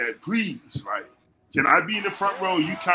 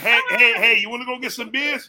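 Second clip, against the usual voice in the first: loud, higher, hammed-up buddy with a laugh; laughing country buddy invite; 5 semitones higher, 7 dB louder than usual. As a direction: call out to the boys like a good ol' country friend. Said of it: "that one country ass friend".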